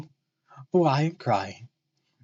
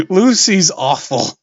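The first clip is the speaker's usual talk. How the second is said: mock disgust; 5 semitones higher, 10 dB louder, slower than usual. Real, not acted. Reader mocks the character.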